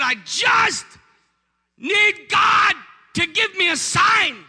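very high, shouted wail; mock weeping. Wife begging pastor for a sign, mock weeping shout. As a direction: drag it out in a loud, hammed-up cry. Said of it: "She starts weeping".

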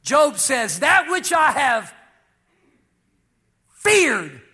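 loud, high-pitched scripture cry; preaching shout. Real, not acted. Preacher quoting Job to the congregation, shouting. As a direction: thunder the verse out with slow, heavy force.

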